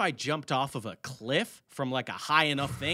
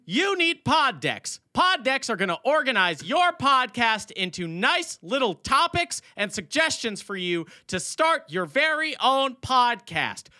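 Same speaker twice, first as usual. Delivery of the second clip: Billy Mays ad read; 8 semitones higher than usual. Acted. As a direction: shout it like an infomercial host. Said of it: "the Billy Mays ad read".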